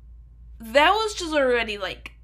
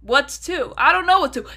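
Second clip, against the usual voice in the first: higher and louder, pretending to be stumped; exasperated mock question; 4 semitones higher, 3 dB louder than usual. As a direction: fake puzzled outburst. Mock quiz with herself.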